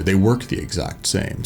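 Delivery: usual speaking voice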